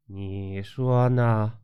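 low, very drawn-out menace; sinister. A narrator's reading. Possessed Wenfeng answering Meizi before attacking, sinister drawl. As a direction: stretch each word darkly, half speed. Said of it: "文凤阴沉地说".

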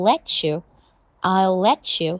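"let you" run together as let-chew; linked pronunciation. Shown as a demonstration. Teacher demonstrating linking of the first three words, linked pronunciation. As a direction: slowly link "let you" with a ch in the middle. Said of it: "连读完了前三个单词就变成".

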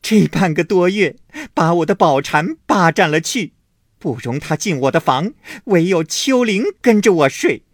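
loud, high tearful wailing; wailing and crying out. A narrator's reading. Wife wailing accusations at husband, loud and tearful. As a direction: wail your grievances at full voice. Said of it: "一面又哭喊说".

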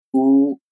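乌 as a long rounded tense oo, lips pushed out. Shown as a demonstration. Teacher demonstrating a long tense oo. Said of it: "发成".

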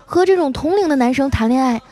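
high pouting voice; 丸子's pouty complaint. Acted. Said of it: "撇撇嘴说". (撇着嘴高声抱怨)

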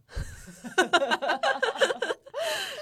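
big high rolling laugh; burst of laughter. Real, not acted. Laughing at her own late marriage and divorce. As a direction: laugh out loud at yourself.

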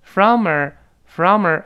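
deliberate demo of linked "From a"; linked weak form. Shown as a demonstration. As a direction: model "From a" as one word, "frommer". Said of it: "from our连读变成from a".

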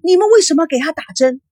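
fast, high, loud question; shocked mother protesting; startled demand. A narrator's reading. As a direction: challenge the nurses in alarm. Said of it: "叶枫惊讶地看着护士".

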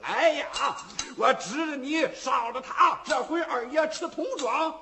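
loud, high excited yelling; excited shout. Acted. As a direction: yell it out as the dice fly. Gamblers yell at the throw.

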